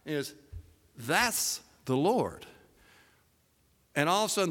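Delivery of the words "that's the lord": very slow, spaced-out words; repeated to be heard. Acted. John to Peter working so hard, slow and distinct. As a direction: spell it out slowly for a distracted listener. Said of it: "Peter can barely hear it".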